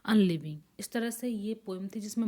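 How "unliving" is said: slow, loud reading of Margaret, are you grieving; poem recitation. Shown as a demonstration. Teacher reciting a verse to show sprung rhythm, slow and loud. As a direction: recite the line slowly, weighing each word. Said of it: "जैसे यहाँ".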